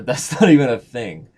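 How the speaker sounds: ordinary talk, nothing marked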